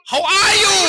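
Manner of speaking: high, bright-pitched calling question; demonstrated teacher's greeting. Shown as a demonstration. Teacher asking children in English how they are, high calling voice. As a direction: call the question out for children to answer. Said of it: "Anak-anak jawab".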